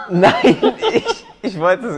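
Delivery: loud laughing protest. Real, not acted. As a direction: laugh out a denial.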